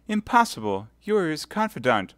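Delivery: high, pleading martyr; wounded innocence. Acted. Milady pleading her innocence to Felton. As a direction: protest innocence with a smile.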